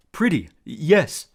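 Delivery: put-on slow, dreamy childlike voice; childlike giant. A narrator's reading. Tyson admiring the golden fleece, childlike. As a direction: marvel like a big kid.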